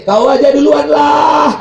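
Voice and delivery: loud, slow, sneering jibe; mocking. Arrogant man mocking the imam's call to prayer, sneering. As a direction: taunt the imam loudly. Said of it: "kata dia mengejek".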